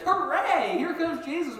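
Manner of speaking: hammed-up joyful welcome; mock cheer. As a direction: disciples cheering mock-happily at Jesus. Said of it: "do you think you're gonna be like".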